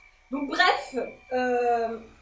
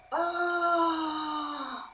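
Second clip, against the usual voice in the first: pleasure cry; 3 semitones higher, 4 dB quieter than usual. Real, not acted. Massage hits a spot.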